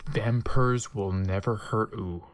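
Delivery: usual speaking voice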